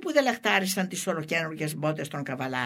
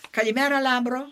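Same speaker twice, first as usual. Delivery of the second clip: high, loud mocking greeting called out; φωνακτός χαιρετισμός; 9 semitones higher, 7 dB louder than usual. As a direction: call it out loud and high, taunting. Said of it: "φώναζαν οι χωριανοί". (κοροϊδευτικό φωναχτό καλημέρα)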